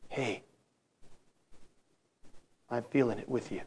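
hushed, intimate reassurance; tender comfort. Retold or imitated. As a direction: say it gently, arm round a hurting friend. Christ comforting a hurting person.

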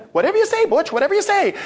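very high, fawning cartoon dog; little rat dog. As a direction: agree eagerly in a squeaky sidekick voice. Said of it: "the little dog comes back".

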